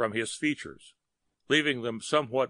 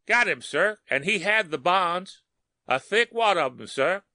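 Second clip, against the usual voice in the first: higher, louder eager policeman; dutiful cop reporting to his superior; 7 semitones higher, 4 dB louder than usual. A narrator's reading.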